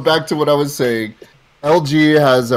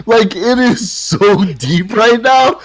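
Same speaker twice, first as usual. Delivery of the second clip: laughing; 12 semitones higher, 3 dB louder, slower than usual. Real, not acted. Laughing at friend's mic.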